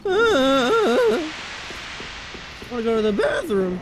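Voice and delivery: squeaky high cracking voice; mock teen voice cracking in class. Shown as a demonstration. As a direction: whine it like a pubescent kid mid-crack. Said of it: "suddenly you go up three octaves".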